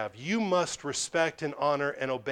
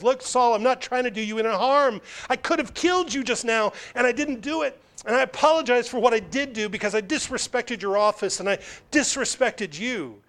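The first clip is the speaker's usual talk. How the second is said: pleading David; 6 semitones higher than usual. Acted. Preacher voices David pleading to Saul.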